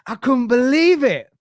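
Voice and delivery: high and loud disbelief; amazed. Real, not acted. Father marvels at his daughter's idea, high and loud. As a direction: sound truly astonished.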